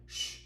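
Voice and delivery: soft parental shush; quiet, drawn-out shh; hushing shh. A narrator's reading. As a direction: hush a child at bedtime. Mother hushes her child.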